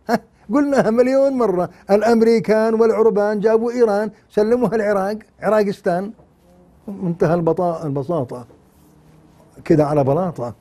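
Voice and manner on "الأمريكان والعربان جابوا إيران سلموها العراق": ordinary talk, nothing marked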